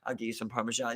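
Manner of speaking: put-on soft, quick Italian-accented offer; lightly imitated Italian waiter. Waiter offering toppings at the table, soft Italian accent. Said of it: "all in, like, an Italian accent".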